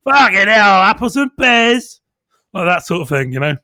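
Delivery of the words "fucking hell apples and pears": loud, high Cockney geezer voice; pearly king. Pretend. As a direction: belt it out like a cheery Cockney market trader. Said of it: "sometimes I end up sounding like".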